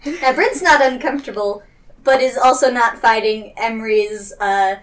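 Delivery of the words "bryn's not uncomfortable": normal talking voice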